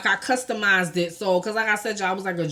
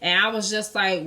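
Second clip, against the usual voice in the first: ordinary delivery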